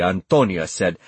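usual speaking voice

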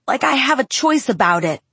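angry shout. Acted. Jacob shouting at Bella about being a werewolf, angry shout. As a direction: throw the words out in anger. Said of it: "he shouted".

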